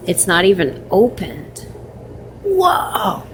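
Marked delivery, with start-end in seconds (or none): 2.4-3.2 surprised whoa. Real, not acted. Finding free chocolate in fridge.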